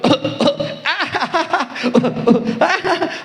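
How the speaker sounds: high copycat coughs breaking into laughter; baby's mock cough with a high laugh; imitated baby cough and giggle. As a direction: mimic a baby fake-coughing, then giggling. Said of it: "o garoto imitou o pai".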